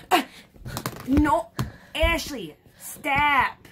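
high frantic yell to stop; yelling at sister. Acted. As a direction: shout at her to quit. Shouting at sister again.

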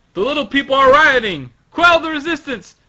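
loud, high, fast command to troops; shouted battle order. Acted. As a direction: yell it like a commander under attack. Acted guard ordering an attack in story.

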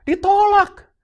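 Ditolak cried out high and loud; a loud, high, pained cry of Ditolak; anguished cry. Real, not acted. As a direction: throw out Ditolak as a pained shout. Emphatic repeat of rejection.

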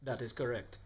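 Trini lilt throughout; Trinidadian accent. Acted. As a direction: speak like a Trinidadian man. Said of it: "raised in Trinidad and Tobago".

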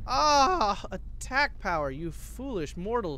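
slow, lightly voiced villain; dark lord. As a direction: gloat slowly like a dark lord. Villain line at units.

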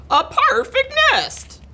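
high-pitched delighted goose voice; excited goose cry. A narrator's reading. Goose admiring the nest, high excited cry. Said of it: "she cried".

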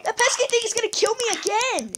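high whiny put-on voice. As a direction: complain in a silly high voice. Whining about the baby zombie.

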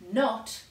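a loud, isolated NOT; NOT hit hard, pause after; emphatic NOT. Real, not acted. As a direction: punch NOT as if written in capitals. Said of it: "in capital letters".

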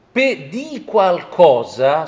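ordinary talk, nothing marked

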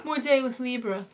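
usual speaking voice

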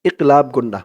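usual speaking voice

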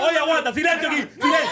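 host shouting for silence over the crowd; calling for silence. Real, not acted. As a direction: hush the whole room.

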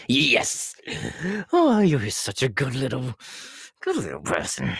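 high, loud, gushing praise; thrilled clown cooing loudly; gleeful delight. Acted. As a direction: gush with creepy delight. Delighted at getting candy.

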